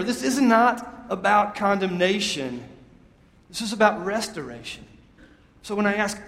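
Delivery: normal talking voice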